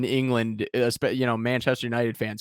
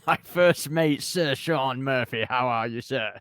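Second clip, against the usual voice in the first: hammy fake British Cockney accent; bad Cockney accent; 2 semitones higher, 4 dB louder than usual. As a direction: overdo a loud Cockney intro. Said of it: "Did you have a stroke when I hit record?".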